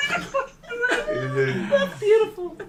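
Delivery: high, tearful laughing delight; real helpless laughter squeezing the words; laughing hard. Real, not acted. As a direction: gasp it out high through laughter. Overcome with laughter at joke.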